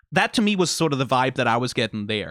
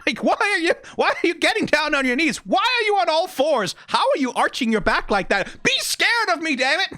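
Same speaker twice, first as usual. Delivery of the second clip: high-pitched, incredulous mock complaint; exasperated monster piling up baffled questions; frustrated werewolf; 13 semitones higher than usual. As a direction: demand to be feared, comically flustered. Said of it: "it's like being a werewolf".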